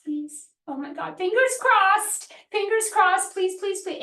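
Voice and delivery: mock desperate begging; desperate pleading. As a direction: plead like your launch depends on it. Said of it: "If my energy is like".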